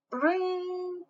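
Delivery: high mock alarm ring; mock alarm clock. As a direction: imitate the alarm clock ringing.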